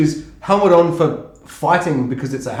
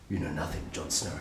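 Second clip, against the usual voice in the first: hushed line; 10 semitones lower, 15 dB quieter than usual. Retold or imitated. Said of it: "she's just like".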